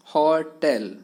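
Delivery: होटल broken into two beats, "ho-tel"; split into syllables. Shown as a demonstration. Teacher demonstrating a two-syllable word, होटल in two separate beats. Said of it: "दो syllable आए इस word में".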